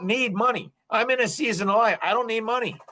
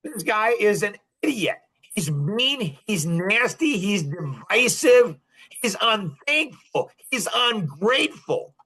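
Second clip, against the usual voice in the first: normal talking voice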